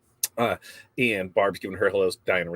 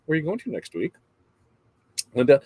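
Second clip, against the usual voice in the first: ordinary talk, nothing marked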